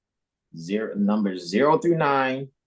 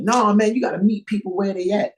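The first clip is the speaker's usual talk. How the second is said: much higher, faster mocking voice; mocking quote; 12 semitones higher than usual. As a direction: parody a preachy critic. Mocking what critics say.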